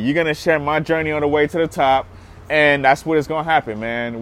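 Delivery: ordinary talk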